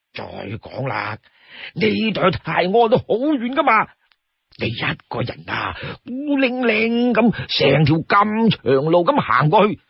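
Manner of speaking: mock-pitying plea with swooping pitch; coaxing Li Kui. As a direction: draw out the loneliness, then perk up. Li Kui pleads pity for companion.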